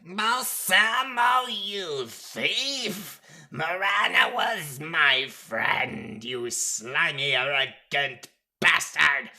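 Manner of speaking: slow, high, furious accusation; outraged shout. A narrator's reading. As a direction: hurl each insult loudly, drawing the words out.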